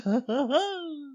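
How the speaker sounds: high manic laugh; hammed-up mad laughter; crazed cackle. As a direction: cackle high like a storybook madman. Mad Hatter voice ends in cackle.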